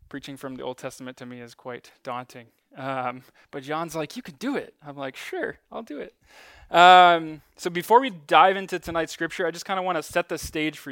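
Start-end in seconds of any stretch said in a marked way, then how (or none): 4.2-4.7 imitating encouragement in a higher pitch; lightly voiced, high-pitched pep talk; colleague's encouragement. Quotes colleague cheering speaker on. 5.2-6.1 high, soft mock agreement; pitched up and quiet, playfully reenacted; hesitant agreement. Quotes own unsure, daunted agreement.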